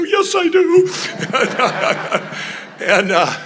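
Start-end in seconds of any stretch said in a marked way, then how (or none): 0.0-0.9 high, loud, eager reply; eager yes. Retold or imitated. Answering Michelle Bachmann's offer to pray, high and eager. 0.9-2.8 breathy laugh. Real, not acted.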